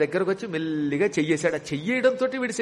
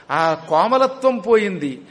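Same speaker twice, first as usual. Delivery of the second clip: normal talking voice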